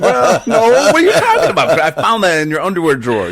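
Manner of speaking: high, loud laughing denial; laughing protest. Real, not acted. Laughing at his cohost's denial, loud and high. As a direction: crack up while denying it.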